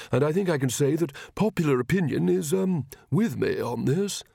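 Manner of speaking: halting, slightly pompous royal voice; hesitant king. Acted. King addresses the departing preacher, hesitant and official. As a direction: play a well-meaning king, unsure of himself.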